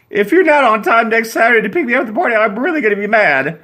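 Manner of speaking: high, loud, fast warning; Susan shouting. Acted. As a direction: shout the threat from the curb.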